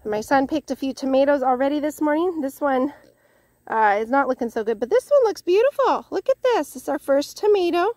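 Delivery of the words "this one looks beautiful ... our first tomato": high, delighted showing-off; excited admiration. Real, not acted. Gardener showing viewers the first tomato, excited and high. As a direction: show it off with a high, excited lift.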